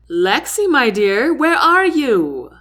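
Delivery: loud, slow call across the crowd. A narrator's reading. Captain calling Lexi across the crowd, loud slow call. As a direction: call out for her over the noise. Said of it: "Captain Barnett's rich voice calls out".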